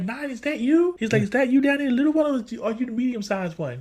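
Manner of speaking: high, confused cartoon voice; dazed Sonic. Sonic, tied up, asks after Nine, high and confused.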